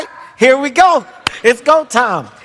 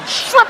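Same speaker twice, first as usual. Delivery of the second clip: very high swish noise; a quick high swipe sound; whoosh sound; 13 semitones higher than usual. Retold or imitated. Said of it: "She said".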